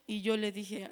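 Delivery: ordinary delivery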